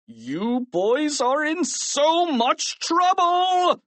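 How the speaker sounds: slow, high, furious scolding; principal's high-pitched bellow; angry shout. A narrator's reading. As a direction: draw out each word in rage. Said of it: "Mister Krupp shouted".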